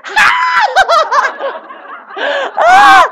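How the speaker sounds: high, loud laughing shriek; loud delighted laughing yell. Retold or imitated. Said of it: "they both went".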